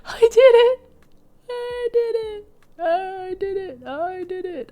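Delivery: high, loud gasp of triumph, fading; triumphant cry. Real, not acted. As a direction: shout the win, then trail off. Triumph as build holds.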